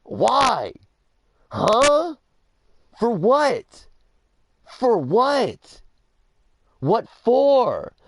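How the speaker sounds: high, loud mock-dad exclamations; incredulous dad. Overheard dad questioning police through the door, incredulous and loud. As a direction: fire off separate high, indignant questions, imitating Dad.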